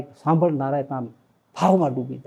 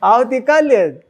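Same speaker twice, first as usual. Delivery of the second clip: higher, louder, slow beaming declaration; joyful emphasis; 8 semitones higher, 6 dB louder, slower than usual. Retold or imitated. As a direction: proudly announce the day of leaving. Devotee repeats emphatically.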